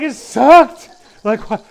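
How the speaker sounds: very loud, high outraged squeal; hurt disbelief at the verdict; mock-wounded yell; shocked. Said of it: "I'm like".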